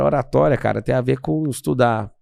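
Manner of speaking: normal talking voice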